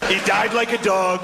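slow, lightly voiced Trump; Trump impression. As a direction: drawl it like Trump. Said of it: "and just goes".

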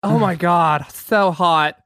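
ordinary talk, nothing marked

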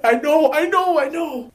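very high, loud squeaky repetition; mock panic. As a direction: squeal it while backing away in panic. Acting out panicked backing away.

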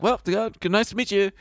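high-pitched mock-polite sign-off; hasty polite goodbye. Hasty goodbye after awful date.

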